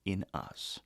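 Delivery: normal talking voice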